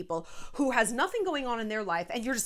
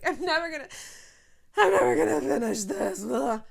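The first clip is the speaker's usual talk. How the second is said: overwhelmed whine; 4 semitones higher, 5 dB louder than usual. Retold or imitated. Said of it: "it's like".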